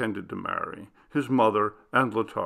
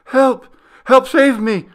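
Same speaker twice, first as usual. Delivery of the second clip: much higher and much louder, desperate scream; terrified cry for help; 11 dB louder than usual. Acted. Clara held over the railing, screaming for help. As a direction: scream for your life. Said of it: "Clara's shrieks became louder".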